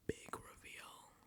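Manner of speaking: slow, hushed announcer tease; dramatic whisper. Pretend. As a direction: whisper it like a suspenseful TV host. Dramatic reality-show build-up.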